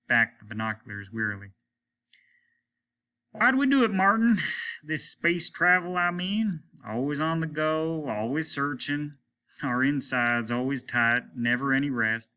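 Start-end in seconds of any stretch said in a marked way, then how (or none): 3.3-12.3 high, strained tired lament; captain pouring out his weariness; weary captain. Acted.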